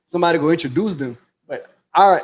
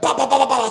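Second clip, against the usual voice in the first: higher-pitched bah bah gunfire imitation; mock gunshots; 8 semitones higher, faster than usual. Retelling the shooting, voicing the gunfire. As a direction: spit out the shots like a gun.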